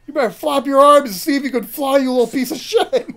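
loud mocking threat; taunting yell. Taunting the kid over the bridge, loud jeer.

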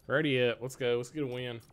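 ordinary talk

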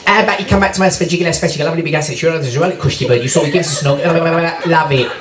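cheeky Essex geezer chat-up; Essex accent. Pretend. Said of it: "I come up to you with the Essex accent".

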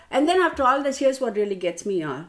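usual speaking voice

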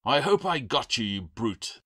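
angry caretaker's shout, high and loud at first; indignant holler that drops away. A narrator's reading. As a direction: shout it at the pest. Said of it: "the caretaker shouted".

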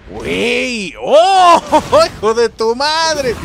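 sudden loud, high yelp then laughing curse; startled outcry. Real, not acted. Gamer startled by the boss appearing, loud yelp. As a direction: jump and swear at the screen.

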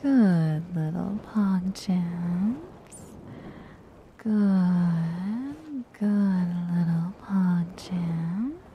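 low, drawn-out deep voice; deep slow voice. Real, not acted. Streamer testing her deep morning voice on chat. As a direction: sink the voice down and drag each word. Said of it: "I wanna be up here, but my voice is like".

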